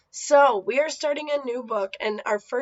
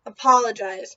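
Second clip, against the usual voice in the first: louder, firm single-word command; insist, leave no room to argue; 6 dB louder than usual. Acted. Missus Jeepers orders Carrie, firm loud command. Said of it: "Missus Jeepers insisted".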